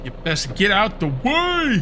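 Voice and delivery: drawn-out yell at zombies; calling out. Pretend. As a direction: holler it slowly, stretching the end. Yelling at zombies blocking him.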